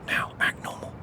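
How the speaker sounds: quick hushed whisper. Real, not acted. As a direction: whisper a warning to stay calm. Whispered instruction.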